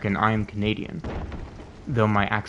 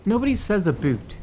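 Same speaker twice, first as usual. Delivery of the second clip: indignant; 9 semitones higher than usual. Real, not acted.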